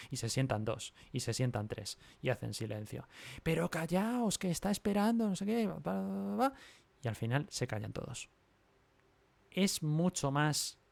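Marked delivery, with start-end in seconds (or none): 3.4-6.5 put-on high, urgent child voices telling others to shush; kids hushing each other.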